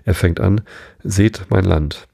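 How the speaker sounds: plain reading voice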